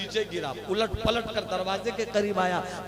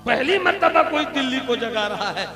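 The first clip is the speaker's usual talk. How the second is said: higher and louder second time, pressing it; louder repeat; 5 semitones higher, 6 dB louder than usual. Acted. Wife insists to the saint, raising her voice. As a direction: drive the repeat home with force.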